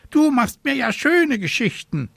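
high-pitched squawky raven scolding; squawking complaint. A narrator's reading. Raven scolding the returning witch, squawking complaint. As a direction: kick up a fuss like an indignant bird. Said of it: "krakeelte er".

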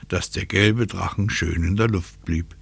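plain reading voice

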